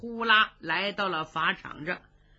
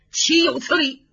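indignant outburst; 5 semitones higher, 6 dB louder than usual. Acted. Emperor fuming at court.

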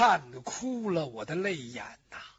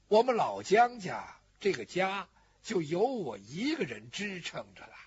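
normal talking voice

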